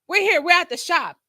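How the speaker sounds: the friend's high, proud arrival call; cheery announcement. Retold or imitated. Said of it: "she says".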